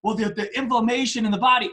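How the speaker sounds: fast, stammered mock confusion; retold bewilderment. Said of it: "this bewildered look on his face".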